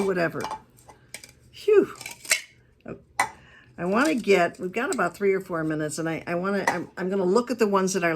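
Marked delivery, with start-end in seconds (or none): 1.5-1.9 high, loud exhale of relief; loud relieved phew. Real, not acted. Speaker relieved after a long story, loud phew. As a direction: blow it out in relief.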